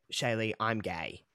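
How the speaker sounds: low, slow and soft admission; quiet confession. Retold or imitated. Coming out to sister, quiet confession. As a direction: say it softly and slowly, as a hard confession.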